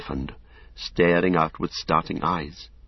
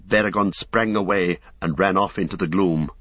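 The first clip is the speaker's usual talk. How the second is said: plain reading voice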